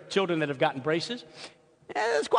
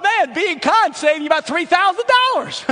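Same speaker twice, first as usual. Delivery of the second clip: much higher and louder, bursting with joy; delighted exclamation; 11 semitones higher, 9 dB louder than usual. Real, not acted. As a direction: shout out the happy surprise. Delighted realisation of savings.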